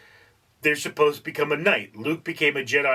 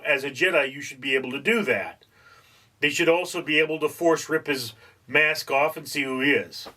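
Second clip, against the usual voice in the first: usual speaking voice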